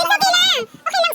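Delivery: loud, high comforting call; high, emphatic it's-all-right; cheerful reassurance. Real, not acted. Parent reassures child after praise.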